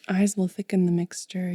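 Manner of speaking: usual speaking voice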